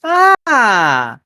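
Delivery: high, loud exclamation as a woman; high-pitched woman's greeting; waitress greeting. Acted. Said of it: "a woman comes by and goes".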